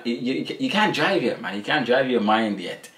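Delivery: normal talking voice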